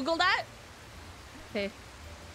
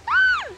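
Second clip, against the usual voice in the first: very high, loud frightened scream; piercing startled shriek; 9 dB louder than usual. Real, not acted. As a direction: scream as if about to fall. Screaming in a scary climbing game.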